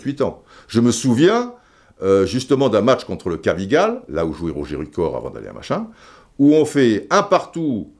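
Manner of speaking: ordinary delivery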